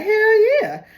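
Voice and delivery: high, squealing hell yeah; a mock-gleeful ex jumping at revenge; eager ex-girlfriend. Ex-girlfriend eager to get back at cheater, high squeal.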